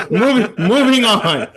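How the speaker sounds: laughing through the words; can't hold back a laugh; giggling out moving on. Real, not acted.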